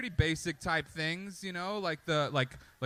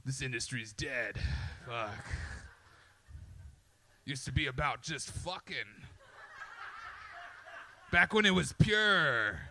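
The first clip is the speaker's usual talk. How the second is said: grizzled porn star; 2 semitones lower than usual. Retold or imitated. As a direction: grumble like a jaded veteran. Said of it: "they're like".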